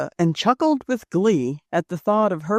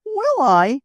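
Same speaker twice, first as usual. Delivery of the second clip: higher, louder eager cry; cried out eagerly; 8 semitones higher, 5 dB louder than usual. Acted. Rose eagerly answering Joe's request, a high excited cry. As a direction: cry it out in happy surprise. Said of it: "cried Rose".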